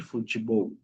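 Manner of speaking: soft, low "Futebol" as fu-chi-bou; Portuguese pronunciation. Shown as a demonstration. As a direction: drop "Futebol" softly, TE as chi. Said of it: "sería futebol". ("Futebol" suave, pronunciado fu-chi-bou)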